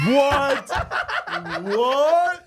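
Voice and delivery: high, loud laughing "What?"; cracking up in disbelief; laughing shout. Real, not acted. Disbelieving a friend's story, laughing out loud.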